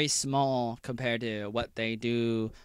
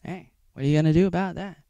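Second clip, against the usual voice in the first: usual speaking voice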